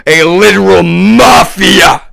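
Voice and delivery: high, loud, drawn-out outraged shout; furious shouting. Real, not acted. Ranting about a literal mafia, furious shout. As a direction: shout it slowly in real outrage.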